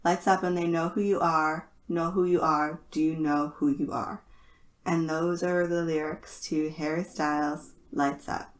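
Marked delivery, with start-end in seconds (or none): none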